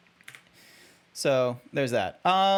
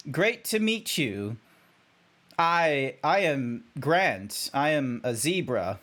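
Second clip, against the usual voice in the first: deadpan, stilted robotic zebra; over-enunciate in measured, swooping phrases; mock-formal creature addressing humans without cracking; straight-faced. Shown as a demonstration. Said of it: "do this as straight-faced as possible".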